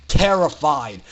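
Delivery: ordinary delivery, nothing marked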